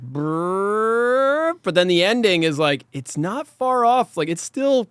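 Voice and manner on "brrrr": rising, drawn-out brrr; imitated rolling brrr sound effect; trilled brrr noise. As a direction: make a long trilled noise climbing upward. Imitates a noise while recapping.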